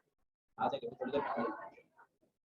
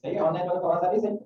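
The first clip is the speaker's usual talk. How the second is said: calling out; 4 semitones higher than usual. Real, not acted. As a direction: project to check the remote students can hear. Checking online students can hear.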